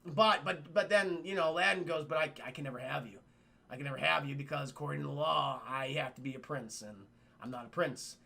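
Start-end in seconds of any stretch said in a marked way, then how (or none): 2.0-8.2 mock dejected. Aladdin says he can't have her.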